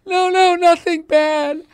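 slow, high, loud mock bawling; hysterical crying. Wife reassures husband after a movie, hammed-up hysterical crying. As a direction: wail it out like an overwrought wife. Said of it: "she was crying hysterically".

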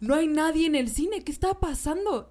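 put-on worried kid.

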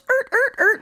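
high-pitched imitated brake screeches; screeching train noise. Showing a derailed train of thought, high screeching noise. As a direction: squeal like a train jolting off its rails. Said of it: "my train just goes".

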